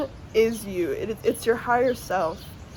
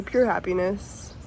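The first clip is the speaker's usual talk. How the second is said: normal talking voice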